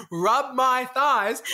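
very slow, deliberate enunciation; very specific. Shown as a demonstration. Giving listeners a hot tip on being clear, slow deliberate delivery. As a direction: draw each word out so nobody mishears.

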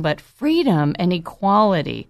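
normal talking voice

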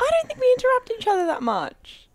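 high, prim mock-offended voice; mock defensive. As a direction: protest too much, sweetly.

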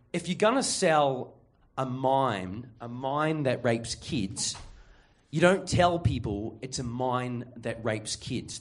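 slow, deliberate, point-making delivery; slow boss lecture. Retold or imitated. Manager explaining his sales logic to Ryan, slow. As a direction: spell it out slowly like a smug manager.

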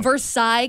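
"Versailles" ending like "sigh"; mock French Versailles. Co-host imagines saying it wrongly about Kentucky, ver-SIGH pronunciation. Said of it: "It's not Versailles".

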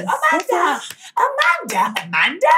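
high, swooping imitated calls; insistent name-calling, leaping in pitch; calling a name repeatedly. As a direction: mimic someone calling out while running up. Imitating runner calling her name.

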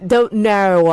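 Australian "Don't know", ending like "naur"; Australian accent. Shown as a demonstration. Teacher echoes the host in an exaggerated Australian accent. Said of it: "one Australian accent, when you say no".

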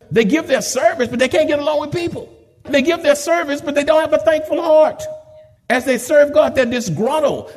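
preaching at full force. Acted. Pastor scolding unthankful saints, building preacher's cry.

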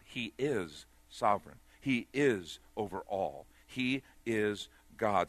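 each line slow, weight on is and God; slow solemn declaration. Acted. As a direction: lay down each truth slowly.

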